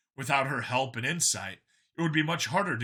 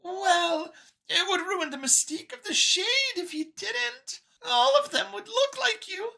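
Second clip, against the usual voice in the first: much higher voice, calm product explainer; Xeno scientist, matter-of-fact. A narrator's reading. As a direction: explain it like a brochure, pitched high.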